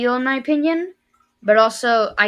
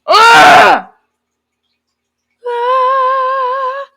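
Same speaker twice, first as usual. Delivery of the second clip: high, loud "ugh" and drawn-out "ah"; high-pitched wail of frustration; frustrated scream; 11 dB louder than usual. Real, not acted. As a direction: let out exasperated yells. Venting after screaming memory.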